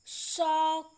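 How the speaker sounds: शौक loud, with au held long. Shown as a demonstration. Teacher modelling au for learners, strong long au in शौक. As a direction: push and stretch the au in शौक. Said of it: "अधिक समय लगता है".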